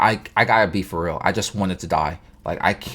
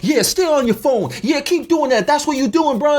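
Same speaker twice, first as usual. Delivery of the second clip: mock angry yell, much higher; shrill-high imitation of her yelling. BMW lady yelling at the Jeep driver, mock angry and high.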